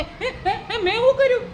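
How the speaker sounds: high, giggling innocent protest; nervous laugh. Acted. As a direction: laugh it off like a guilty buffalo. Buffalo caught, nervous laugh. (ગભરાયેલું હસતું બહાનું)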